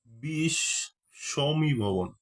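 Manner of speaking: বিষমীভবন drawn out very slowly; very slow careful term; slow dictation. Shown as a demonstration. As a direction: spell out বিষমীভবন for the class. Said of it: "teacher dictating new term".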